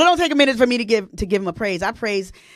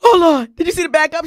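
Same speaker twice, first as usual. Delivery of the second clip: loud, high-pitched exclaiming as she did; mock exclaim; 9 semitones higher, 7 dB louder than usual. Retelling what she said, loud mock exclaim. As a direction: blurt it out like she's shocked. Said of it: "She said".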